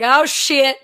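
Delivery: freaking out. Retold or imitated. A fan spotting her idol, freaking out aloud. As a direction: lose it in starstruck panic. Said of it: "I started freaking out".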